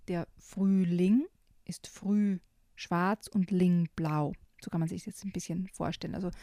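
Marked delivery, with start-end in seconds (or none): 0.1-4.3 slow, split into syllables; Früh ... ling spoken slowly, syllable by syllable. Shown as a demonstration. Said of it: "Zum Beispiel".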